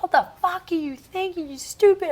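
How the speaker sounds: high-pitched outraged rant; mock-furious, high indignant scolding; retold anger. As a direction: replay losing it, pitch shot way up. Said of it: "I was so mad".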